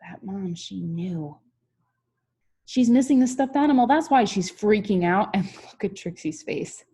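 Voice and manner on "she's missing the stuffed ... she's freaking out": loud, fast realization; knowing mom. A narrator's reading. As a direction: blurt it out as the mom figures it out. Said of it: "Her mom knew it".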